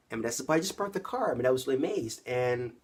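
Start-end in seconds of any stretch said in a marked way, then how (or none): 0.5-1.3 much higher, incredulous; stunned disbelief. Retold or imitated. Dad stunned when handed his son, incredulous. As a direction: jump way up in pitch, bewildered.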